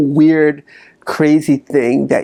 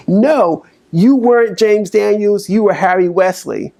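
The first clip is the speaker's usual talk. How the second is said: much higher, gloating correction; gleeful inner critic; 7 semitones higher than usual. Retold or imitated. Coach voicing the inner critic gloating over his birth name, higher. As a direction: gloat like you caught him out. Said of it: "so happy to be like".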